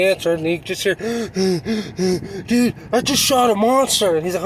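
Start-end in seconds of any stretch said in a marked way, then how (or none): 1.0-2.4 a quick, sharp gasp of excitement; excited gasp. Retold or imitated. Hunter gasps into the phone to his brother, excited gasp. Calling brother after the shot. 2.5-4.2 higher, breathless excited shout-out. Retold or imitated. Hunter tells his brother on the phone about the buck, higher excited burst. Calling brother right after the shot.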